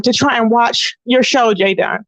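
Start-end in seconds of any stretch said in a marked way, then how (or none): none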